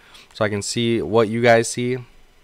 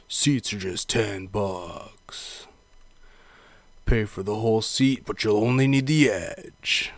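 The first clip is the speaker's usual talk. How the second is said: whispered then announcer ad read; monster truck ad; 5 dB quieter, slower than usual. Shown as a demonstration. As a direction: hype it like an arena ad. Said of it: "those old monster truck commercials".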